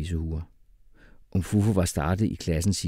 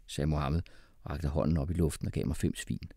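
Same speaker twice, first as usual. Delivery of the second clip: usual speaking voice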